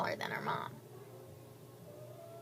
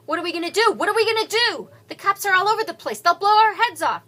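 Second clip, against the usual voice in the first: panicked; 10 semitones higher, 12 dB louder than usual. Acted. Panicked gunman voiced in story.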